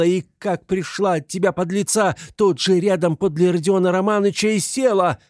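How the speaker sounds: high, loud, frantic tirade; hysterical Katerina Ivanovna, enraged. A narrator's reading. Katerina defending Sonya against Luzhin and landlady, furious hysterics. Katerina rages at her accusers.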